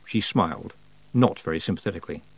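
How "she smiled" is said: ordinary delivery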